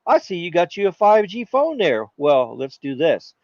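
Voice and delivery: high, friendly greeting to Carlos; a cheery cell tower welcoming a 5G phone; chummy tower. Pretend. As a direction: greet him like an old pal. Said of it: "And the tower says".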